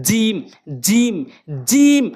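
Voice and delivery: জিম slowly, firm j and long ee; correct jeem drill. Shown as a demonstration. Teacher drills learners, slow firm জিম. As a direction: press mid-tongue to palate and draw out জিম. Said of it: "এভাবে উচ্চারণ করবেন".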